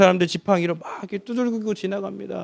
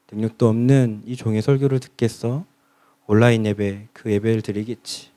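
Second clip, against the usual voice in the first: low, humbled inner voice; self-deprecating musing; 8 semitones lower than usual. Retold or imitated. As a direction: sigh over your own smallness. 목사의 자조적 속마음. (스스로를 낮추는 자조적 혼잣말)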